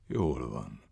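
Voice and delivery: low, soft acceptance; gentle. A narrator's reading. Master reassures the self-blaming man, gently. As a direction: soothe the outburst calmly. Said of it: "folytatta szeliden a mester".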